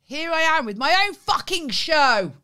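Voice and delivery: loud, high, drawn-out declaration; mock showbiz announcement. Host trumpeting their own show, loud announcement. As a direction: announce it big and slow.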